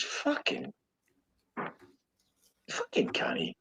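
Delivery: breathless whispered curse, barely voiced; stunned whisper. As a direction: mutter it under your breath in disbelief. Retelling his own stunned reaction.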